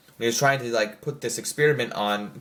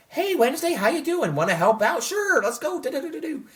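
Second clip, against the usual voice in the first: much higher, bright sing-song friendly banter; cheery mock scene. Vlogger imagining the scene he wanted, a high chirpy voice. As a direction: pitch it way up like a peppy buddy. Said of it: "It wasn't like a".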